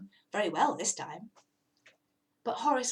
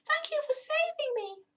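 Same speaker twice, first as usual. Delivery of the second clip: grateful cat. Acted. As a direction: gush thanks with relief. Rescued cat thanks rabbit.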